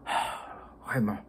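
usual speaking voice

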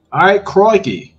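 playful mock Aussie twang; hammed-up "crikey" in a put-on Australian accent; British or Australian accent. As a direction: throw in a light, jokey British-Australian accent. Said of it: "in my British voice, okay? Or Australian".

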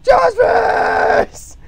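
long drawn-out shout climbing very high; silly stretched-out battle cry; yelled caricature. Acted. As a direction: build to a high, loud mock scream. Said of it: "And then he's like".

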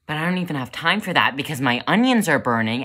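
loud, fast, frantic burst; rushed panic. Acted. Cook whose onions are burning, rushed and loud. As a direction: snap back into hurried panic.

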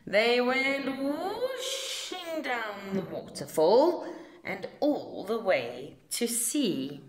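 ordinary delivery, nothing marked

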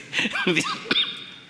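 high, short laugh; a surprised chuckle; sick man's laugh. Retold or imitated. Sick man laughs at the pastor's joke, high laugh. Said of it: "me mira él y me dice".

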